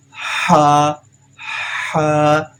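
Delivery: Ha slightly stretched from the throat. Shown as a demonstration. Teacher showing students the stretch: slightly drawn-out Ha. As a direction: hold Ha a little, breathy. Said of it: "and little bit stretch".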